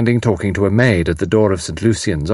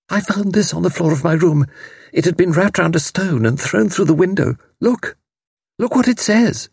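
high, urgent mother's voice; agitated mother; 10 semitones higher than usual. A narrator's reading. Mother shows the thrown note to everyone, agitated. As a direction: report it high, climbing to urgent commands.

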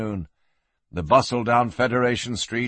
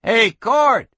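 high, loud holler from another room; calling out; 10 semitones higher, 6 dB louder than usual. Acted. Young man out back hailing the sheriff, calling out. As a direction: hail a friend at a distance.

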